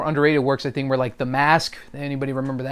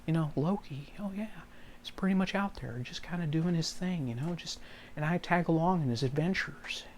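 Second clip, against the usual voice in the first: Owen Wilson; 2 semitones higher, 14 dB quieter than usual. Acted. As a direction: murmur it dreamily, in mild wonder. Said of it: "a little bit of Owen Wilson".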